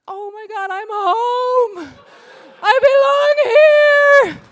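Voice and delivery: ecstatic cry of belonging. Retold or imitated. Comic seeing the girl with a mouthful of wool, overjoyed cry. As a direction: cry it out like you've finally found your people. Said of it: "and I'm like".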